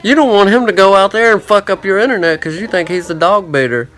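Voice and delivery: high, loud, frantic voice of his own worries; paranoid inner voice. Retold or imitated. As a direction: let the nagging thought shout at you. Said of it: "the thought popped into my head".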